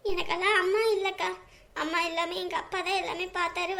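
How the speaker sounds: normal talking voice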